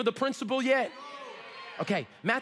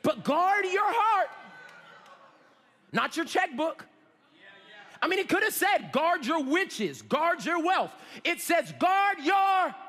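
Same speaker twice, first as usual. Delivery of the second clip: impassioned preaching; 8 semitones higher, 4 dB louder than usual. Real, not acted. Preacher urging congregation to guard the heart, impassioned. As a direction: preach it to the crowd, stretching key words.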